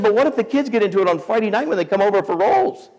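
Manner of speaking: high, rapid put-on worry; imitated fretting wife.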